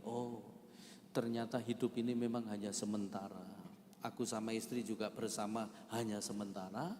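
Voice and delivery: low, soft reflection on life's brevity; quiet pondering. Retold or imitated. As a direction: let it sink in, hushed and low. Retells quiet realisation at wife's grave.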